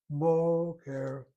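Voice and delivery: boker with a long, punched BO; stress on first syllable. Shown as a demonstration. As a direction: lean hard on BO, let ker drop away. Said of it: "is over that first syllable".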